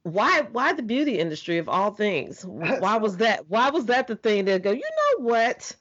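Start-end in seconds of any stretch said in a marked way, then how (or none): 2.4-5.7 playful inner voice. Retold or imitated. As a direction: act out the thought in a lighter, higher voice. Host voices an inner thought.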